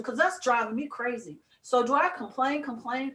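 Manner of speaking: usual speaking voice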